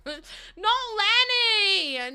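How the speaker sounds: high, slow wail through laughter; crying out with laughter and mock grief; laughing shout. Real, not acted. Streamer reacting to a sad game moment, laughing wail.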